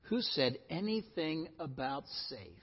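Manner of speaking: hushed, weighty repeat; quiet emphasis. Shown as a demonstration. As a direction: drop the voice to drive it home. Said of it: "do you hear what I'm saying".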